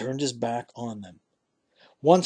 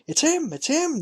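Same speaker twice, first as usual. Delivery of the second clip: much higher, eager cry of recognition; excited shout. A narrator's reading. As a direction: shout it up high, overjoyed. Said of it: "the fisherman shouted".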